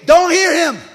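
high, loud shouted plea with a laugh; mock shout. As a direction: shout up to God half in jest. Preacher shouting to God.

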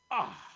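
loud, low "ah" of dismay; loud exclaimed sigh. Real, not acted. Preacher reacting to the servant's punishment, dismayed exclamation. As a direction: let out a heavy, loud "ah".

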